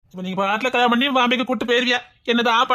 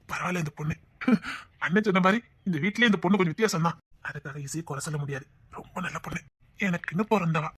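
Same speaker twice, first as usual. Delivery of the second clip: soft, low murmur to oneself; fond musing; 4 semitones lower, 7 dB quieter than usual. Acted. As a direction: muse quietly and fondly, pitched low. Musing fondly about the girl. (மென்மையான, அன்பான முணுமுணுப்பு)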